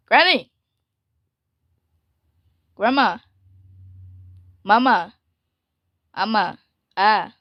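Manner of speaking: loud impatient calls, rising; real calling out to Granny. Real, not acted. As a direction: shout for her across the house.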